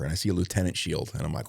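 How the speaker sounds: usual speaking voice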